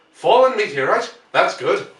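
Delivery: loud, high, delighted surprise; excited reply. Acted. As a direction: react with eager interest. Henderson reacts to news.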